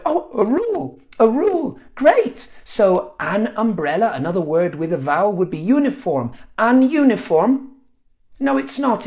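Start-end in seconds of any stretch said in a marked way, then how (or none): 0.0-2.5 high, louder sarcastic glee; mock delight. Learner mocks English rules with fake high glee. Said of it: "And I went". 6.6-7.7 high, louder deliberate wrong word; mock mistake. Shown as a demonstration. Teacher shows the rule failing, high and loud. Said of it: "applies the rule".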